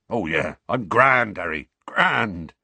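a hint of a glum giant; slow false cheer from a big man; gloomy Hagrid. A narrator's reading. Hagrid voiced, morose.

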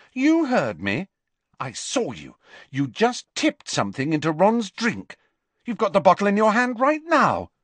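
high, indignant accusation; accusing. Acted. Hermione confronts Harry over the bottle, accusing.

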